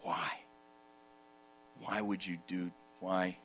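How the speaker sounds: soft, low and intimate, drawing them in; hushed preaching. Acted. Preacher drawing the congregation close, hushed and low. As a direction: let the voice drop right down, tender and near. Hushed turn to God's love.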